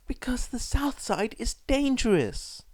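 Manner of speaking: high, hushed whispered mother; firm warning. A narrator's reading. Mother warning her son, hushed and high. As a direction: warn him in a tense whisper. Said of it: "Inko told him".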